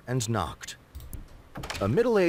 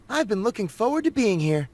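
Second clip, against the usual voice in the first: Rachel voice; 9 semitones higher than usual. A narrator's reading. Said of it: "she said".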